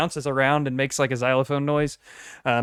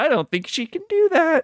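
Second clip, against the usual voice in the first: high-pitched voice. Retold or imitated. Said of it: "'cause I was like".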